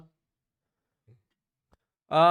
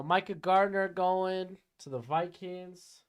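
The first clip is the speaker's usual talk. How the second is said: ordinary talk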